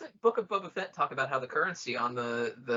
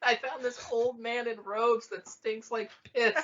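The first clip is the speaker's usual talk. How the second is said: put-on high, excited kid telling mom; kid Anakin impression; 9 semitones higher than usual. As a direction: blurt it out like an excited boy.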